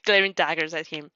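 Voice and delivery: usual speaking voice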